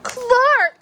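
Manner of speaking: high, loud upset cry; cried. Acted. Friend crying out at his broken toy. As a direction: call him out in shock. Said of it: "cried Joey".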